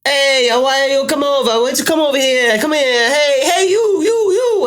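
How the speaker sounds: very high, loud mock hollering; screaming market vendor. Market seller calls to a passer-by, screaming. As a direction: imitate a pushy seller yelling at passers-by. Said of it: "screaming for customers".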